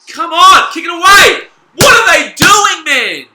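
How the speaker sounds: real high, loud yell at the players; exasperated shouting. Real, not acted. Fan berating his team during the match, exasperated shout. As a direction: shout it in disbelief at your team. Fan yells at team's mistakes.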